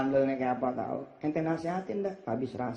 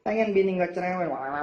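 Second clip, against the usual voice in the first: ordinary talk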